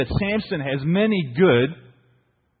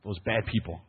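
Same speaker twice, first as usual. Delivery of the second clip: much softer, lower, conspiratorial imitation; hushed mock warning; 6 semitones lower, 9 dB quieter than usual. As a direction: lean in and warn them under your breath. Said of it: "He goes".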